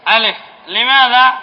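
ordinary talk

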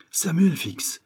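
high-pitched cry of recognition; exclamation. A narrator's reading. Detective discovers the captive reporter, crying out. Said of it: "s'écria-t-il".

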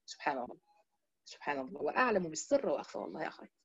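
very soft, low murmur of praise; hushed awe. Real, not acted. Teacher pausing to marvel at God's knowledge, hushed awe. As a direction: drop right down to a reverent undertone.

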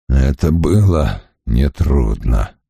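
low, slow hoarse voice; hoarse rasp. A narrator's reading. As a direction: rasp it out, hurt. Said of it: "прохрипел он".

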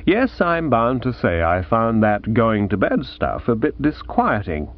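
usual speaking voice

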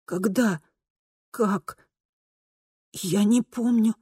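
soft, halting, lost; stunned dread. A narrator's reading. Kristina fearing she has died, hushed and stunned. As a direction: let each question out quietly, bewildered.

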